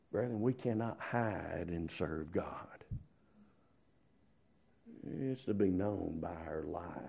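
normal talking voice

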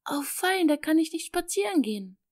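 high, quick squeal of joy; overjoyed. A narrator's reading. Little girl thrilled her nursery gets cleaned, high and fast. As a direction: jump for joy with the words. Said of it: "vollführte vor Freude einen Luftsprung".

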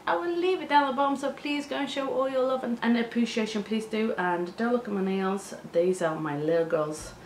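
usual speaking voice

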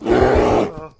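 loud cry of alarm while fleeing; panicked yell. Real, not acted. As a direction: scream as the bear closes in. Fleeing bear on horse.

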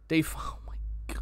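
whispered under the breath. Real, not acted. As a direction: hush it to yourself. Whispered to self.

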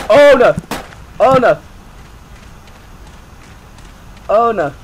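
loud, high "oh no"; alarmed cry. Real, not acted. Gamer spotting danger, loud alarm. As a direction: yelp it in alarm.

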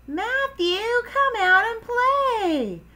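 high, drawn-out singsong call; hollering. Acted. Froggy at Matthew's house, hollering for him. Calling friend outside, as before.

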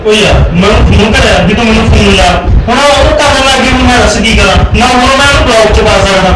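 pleading exasperation in a quarrel; exasperated protest. Acted. Exasperated defence in couple's quarrel.

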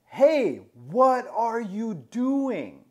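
high, drawn-out mock yell; retold scream. Man yelling across the street at friend's attacker, mock scream. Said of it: "And I scream".

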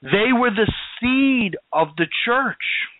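deliberate stress, lingering on "seed" before "of the church"; stressed key point. Real, not acted. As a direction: stretch out "They were the seed" to drive it home. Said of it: "I just want to stress something else".

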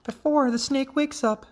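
high, urgent mother voice; alarmed mother frog. A narrator's reading. Mother to her young beside sleeping snake, high urging.

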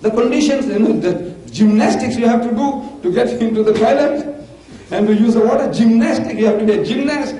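ordinary delivery

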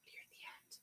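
breathy hushed hint; whisper. Real, not acted. Teacher whispering a hint to her young students.

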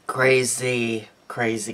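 ordinary talk

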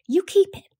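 lightly voiced young boy pressing back; a boy's voice. A narrator's reading. Young Jem to Calpurnia, a lightly voiced insistent boy.

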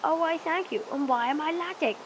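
a put-on grateful voice, high and loud; mock flattered reply. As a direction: play it up as the flattered genius. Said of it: "And I'll be like".

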